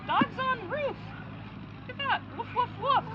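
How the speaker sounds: high, animated story voice; reading to a toddler. A narrator's reading. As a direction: read it playfully to small kids.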